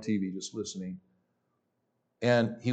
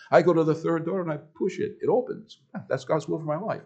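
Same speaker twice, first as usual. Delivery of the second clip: imitated Lebanese accent, pitched higher; 5 semitones higher than usual. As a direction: quote the preacher in a lilting Lebanese accent. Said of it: "in his Lebanese accent".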